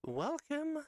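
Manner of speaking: a high stretched welcome; high sing-song welcome. Acted. Host greeting viewers with a high sing-song welcome. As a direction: sing it up high, bright and playful. Playful triple welcome intro.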